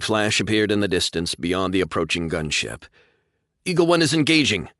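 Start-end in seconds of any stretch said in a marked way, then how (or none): 3.7-4.7 higher, louder combat radio call. A narrator's reading. Pilot reporting to command, urgent and raised. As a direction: report in over the comms, urgent.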